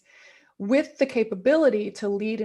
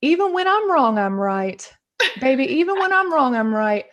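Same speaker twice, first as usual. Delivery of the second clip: put-on Southern dad accent; 5 semitones higher, 6 dB louder than usual. Daughter imitating her Southern dad telling her, in a broad Southern accent. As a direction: boom it out like a tough Southern father in his Southern accent. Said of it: "I always bust into my Southern accent".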